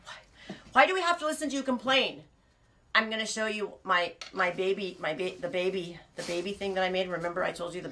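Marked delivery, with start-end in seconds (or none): 0.1-2.2 an unvoiced sigh, then a high, louder whine; mock whiny viewers. The host mimicking viewers sick of her complaining, whiny. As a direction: play the bored audience whining. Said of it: "You guys are like".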